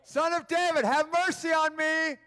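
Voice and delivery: high, drawn-out call for mercy; acted beggar's shout. Blind man hears Jesus is near and calls out, shouting. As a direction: holler it high over a crowd. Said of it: "He shouts".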